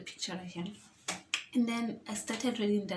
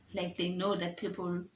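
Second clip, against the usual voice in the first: ordinary talk, nothing marked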